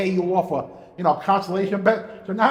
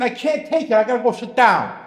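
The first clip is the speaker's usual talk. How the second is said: raised-pitch mock outburst; retold cry, pitched up at first; imitated screaming; 5 semitones higher, 5 dB louder than usual. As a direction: imitate a bettor overwhelmed by the race. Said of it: "Angel starts screaming".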